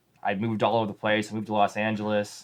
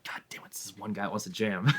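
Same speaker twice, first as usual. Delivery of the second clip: exasperated whisper under the breath; 7 dB quieter than usual. Retold or imitated. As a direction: whisper it to yourself, annoyed. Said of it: "And then I was like".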